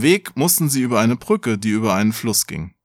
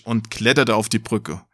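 ordinary delivery, nothing marked

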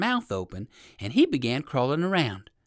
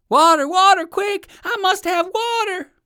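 frantic cry for water; 9 dB louder than usual. Acted. Alligator's mouth burning.

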